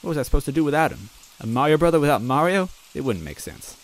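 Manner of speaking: ordinary delivery, nothing marked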